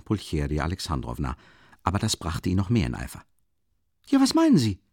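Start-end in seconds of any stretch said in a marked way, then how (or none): 4.1-4.8 much higher and louder, heated; raised voice. Acted. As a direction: fire back at her, voice climbing. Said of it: "erhob seine Stimme noch mehr".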